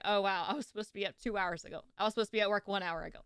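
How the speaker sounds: fast rushed realization; quick flustered self-quote; retold panic. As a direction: rattle it off in a panic. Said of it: "I'm like".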